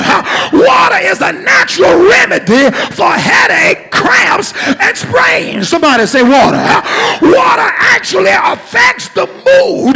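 ringing call-and-response sermon; preaching. Acted. Pastor leading congregation call and response, rousing preaching. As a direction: drive each point home, then call for the answer.

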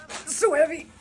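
high, loud effortful strain; straining under weight. Real, not acted. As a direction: force it out while heaving a heavy load. Struggling with a heavy sewing machine.

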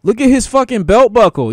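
loud, high, incredulous outburst; loud disbelief. Real, not acted. Streamer yelling at a fighter on screen, loud. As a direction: call it out in shock. Reacting loudly to game fighter.

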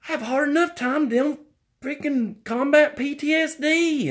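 high pleading complaint voice; put-on whiny voice. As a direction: whine like a put-upon victim.

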